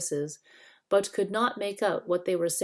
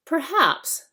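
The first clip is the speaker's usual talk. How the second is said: doctor's query; 10 semitones higher, 7 dB louder than usual. Acted. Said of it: "said Cornelius".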